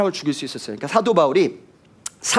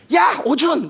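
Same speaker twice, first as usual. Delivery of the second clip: higher, sharp call of the full name with a laugh; mock scolding call; 10 semitones higher, faster than usual. Shown as a demonstration. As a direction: call out like a parent about to scold. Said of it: "이런 이름만 들어도 알죠".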